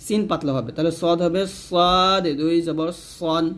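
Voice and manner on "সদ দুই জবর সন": সদ long, thick s with rounded vowel, ending সন; slow, full-mouthed s in সদ and সন; heavy ṣād. Shown as a demonstration. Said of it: "তবে এখানে সদ মোটা করতে হবে". (সদ মোটা করে টেনে, সন)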